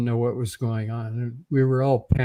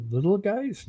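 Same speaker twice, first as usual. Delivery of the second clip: incredulous question; 9 semitones higher than usual. Retold or imitated. Disbelief at creature description.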